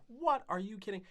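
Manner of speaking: high, loud what, are you kidding; shocked disbelief. Retold or imitated. Hearing he's a suspected poacher, a high, loud shocked reply. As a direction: blurt out stunned disbelief.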